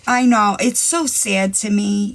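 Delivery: ordinary talk, nothing marked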